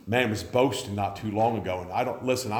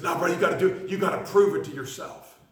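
imitating pushy preacher; 9 semitones higher, 4 dB louder than usual. Pushy young preacher quoted.

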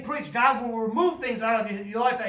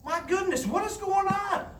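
astonished exclamation; 9 semitones higher than usual. Acted. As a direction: throw up your hands in disbelief. Exasperated preacher exclaiming.

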